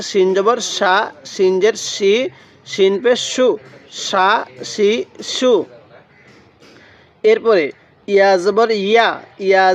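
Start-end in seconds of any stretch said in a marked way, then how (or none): 4.1-5.5 সা, শি, সু as shaa, shee, shoo; full "sh" on each of সা, শি, সু; thick sh. Shown as a demonstration. Teacher repeating shin for learners, সা, শি, সু with thick "sh". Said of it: "এটা মোটা করে পড়ব".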